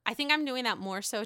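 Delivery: ordinary delivery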